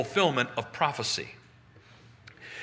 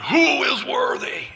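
big, drawn-out, high booming call; 11 semitones higher, 6 dB louder than usual. Acted. Strong angel calling to all creation, booming. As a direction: boom it out like a mighty angel. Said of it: "as he booms it out".

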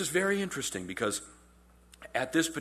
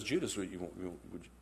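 quiet address; 8 semitones lower, 11 dB quieter than usual. Retold or imitated. Jesus quietly addressing Judas.

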